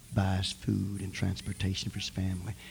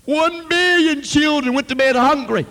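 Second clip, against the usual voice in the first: much higher, much louder shout; anguished shout; 13 dB louder than usual. Retold or imitated. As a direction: hurl the repeat at heaven. Repeat, preacher crying out louder.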